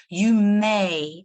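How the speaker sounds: "may" punched out loud, teacher's warning; stress on may. Shown as a demonstration. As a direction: lean hard on "may". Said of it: "I'm trying to emphasize the word may".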